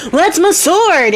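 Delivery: high, stretched-out exclamation; hammed-up astonished dad; startled recognition. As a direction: cry out in sudden recognition, drawn out. Dad recognizes his sword, jumps up.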